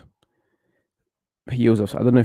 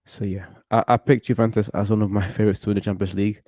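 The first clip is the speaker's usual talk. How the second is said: usual speaking voice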